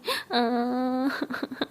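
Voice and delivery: put-on whimpering wail; childish mock crying. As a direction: play a little kid's fake sobbing. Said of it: "क्या बच्चों की तरह रो रही हो". (बच्चों जैसा नकली रोना)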